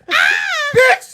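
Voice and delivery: high, very loud startled shriek; reenacted scream. Host reenacting his scream in the theater, screaming. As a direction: scream it out like a movie jump scare. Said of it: "So she screamed and I screamed".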